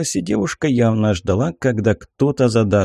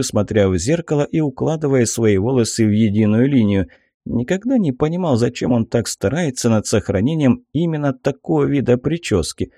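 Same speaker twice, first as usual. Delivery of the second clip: ordinary delivery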